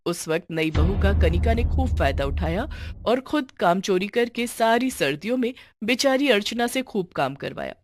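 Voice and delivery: ordinary delivery, nothing marked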